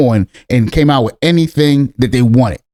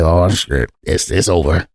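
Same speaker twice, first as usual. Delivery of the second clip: much lower, deflated mumble to himself; low mock despair; acted dejection; 12 semitones lower, 3 dB quieter, slower than usual. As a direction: act out a sinking realization, low and soft. Acts out his dejected first reaction.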